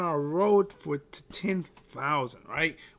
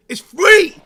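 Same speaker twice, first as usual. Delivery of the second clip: excited shout; 13 semitones higher, 15 dB louder than usual. Real, not acted. Hyping his free content.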